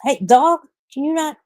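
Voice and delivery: high, exasperated call; scolding the dog. Real, not acted. Streamer annoyed by a licking dog, exasperated scolding.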